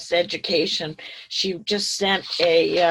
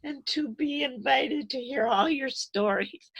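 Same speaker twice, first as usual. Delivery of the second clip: still tearful; 7 semitones higher than usual. Real, not acted. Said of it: "I'm sorry I'm crying".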